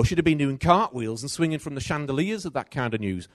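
usual speaking voice

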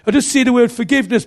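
high, loud prophetic declaration; prophetic word. Retold or imitated. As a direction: announce it like a revelation. Carl gives a word.

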